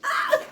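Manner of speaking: high mock yelp of fright; startled yelp. Bedmate startled awake by her twitch, high mock yelp. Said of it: "and they're like".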